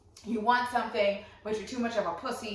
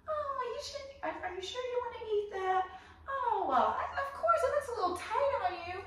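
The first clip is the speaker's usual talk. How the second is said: high, sing-song fake concern; passive-aggressive; 13 semitones higher, 4 dB quieter than usual. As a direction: feign sweet concern in a much higher, softer voice.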